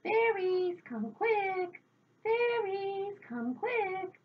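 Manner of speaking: high, carrying call; shouted summons. A narrator's reading. Wise woman showing what to shout, high calling voice. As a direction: call out urgently to someone indoors. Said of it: "Stand outside your door and shout".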